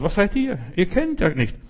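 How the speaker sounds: hoch und spöttisch; mock demon sneer. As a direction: die Eindringlinge verhöhnen. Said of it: "Satan sagt". (höhnische Teufelsstimme, hoch)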